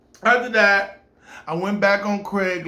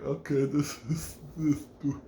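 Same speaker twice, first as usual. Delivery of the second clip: nervous; 4 semitones lower, 8 dB quieter than usual. Retold or imitated. Nervous at spooky spider web.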